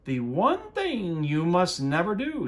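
high loud warning on never; stern warning. Acted. Witch warning Anthony off the pot, stern loud warning. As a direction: lean hard on the warning. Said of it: "said Stregonona".

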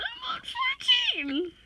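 very high, slow, near tears; overwhelmed squeal. Real, not acted. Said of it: "I'm freaking out".

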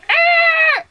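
very high held squeal; princess's battle shriek. Acted. Purple princess attacking the little guy, high mock shriek. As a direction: let out a piercing cartoon war cry. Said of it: "the purple princess was like".

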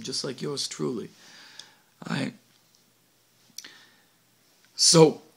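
ordinary talk, nothing marked

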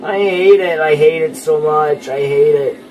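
loud, high despairing complaint; real frustrated meltdown; exasperated wail. Real, not acted. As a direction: wail it over and over, easing down in pitch. Losing at game.

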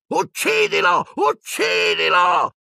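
high, drawn-out screaming command; dark lord screaming at his servant to kill; shrill shout. Acted. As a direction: screech the order over and over. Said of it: "Voldemort che gridava".

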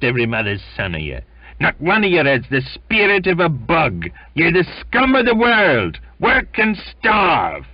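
high, loud, raging shout at the crew; furious sneering tirade. Acted. Sailor rages at the crew who refuse his drink, loud and furious. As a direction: spit contempt at the whole crew.

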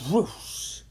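breathy, voiceless whoosh noise; whoosh sound. A narrator's reading. Narrator voicing Jimmy being sucked inside, whoosh sound. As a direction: imitate a rush of air.

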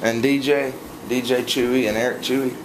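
usual speaking voice

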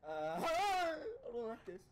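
high scared laughing whine; nervous laughing. Real, not acted. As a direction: laugh through the fear.

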